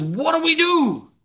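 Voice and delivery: high, drawn-out plea; pleading cry. Acted. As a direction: cry it out like a crowd desperate for an answer. Crowd cut to the heart pleads.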